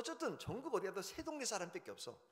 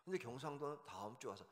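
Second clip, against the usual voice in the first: ordinary talk, nothing marked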